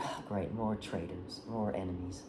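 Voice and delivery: low, soft, unimpressed grumble; acted sardonic aside, dropped low and quiet; weary sarcasm. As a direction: grumble it under your voice, fed up. Acts out mother's weary aside.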